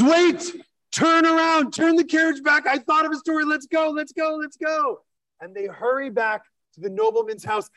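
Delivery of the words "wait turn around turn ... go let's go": much higher, urgent and thrilled; sudden excitement. Acted. Reb Yaakov to the driver, urgently excited. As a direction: stop the carriage, he remembered a story.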